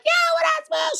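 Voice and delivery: very high, girly mock greeting; Tisha Campbell greeting. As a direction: sing it out in a falsetto.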